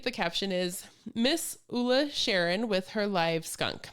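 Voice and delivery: ordinary talk, nothing marked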